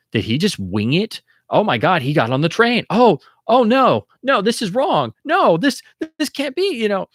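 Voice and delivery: very high, strained alarm, slowing on oh no; panicked viewer. Retold or imitated. Viewer realizes Grant fooled Bond, high panicked squeal. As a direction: squeal in horror as he boards the train. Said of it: "you're automatically going".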